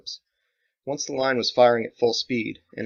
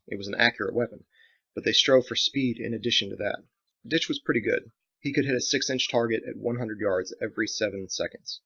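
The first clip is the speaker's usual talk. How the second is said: plain reading voice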